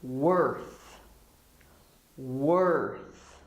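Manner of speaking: WORTH said slowly, tongue out on the final TH; clear TH. Shown as a demonstration. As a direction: model "Worth" with a crisp final TH. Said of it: "You have to put your tongue out".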